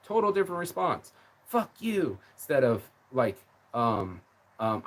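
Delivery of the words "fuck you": mock angry retort. Mocking her rude reply.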